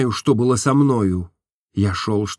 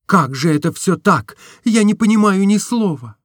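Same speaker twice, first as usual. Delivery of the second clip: put-on higher, lighter girlish voice for Nastenka; Nastenka's voice; 9 semitones higher, 4 dB louder than usual. A narrator's reading. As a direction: voice the young woman, tender and open. (голос Настеньки, выше и легче)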